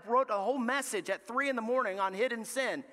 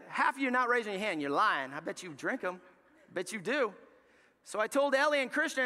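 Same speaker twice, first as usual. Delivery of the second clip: ordinary talk, nothing marked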